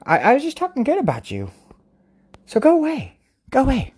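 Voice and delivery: high mock-annoyed shooing; playful scolding. Real, not acted. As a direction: shoo the cat off playfully. Scolding the scratching cat.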